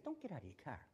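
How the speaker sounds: normal talking voice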